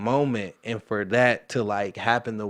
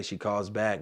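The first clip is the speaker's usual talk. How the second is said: usual speaking voice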